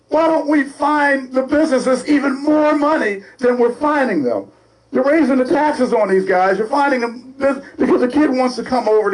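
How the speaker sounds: can't believe what they're doing; exasperated rant. Real, not acted. Ranting about fining businesses.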